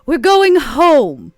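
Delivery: loud, very slow call; a loud, drawn-out summons home. Acted. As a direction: stretch it out so he hears across the lot. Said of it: "I toss it out lou-, loud enough".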